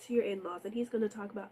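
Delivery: normal talking voice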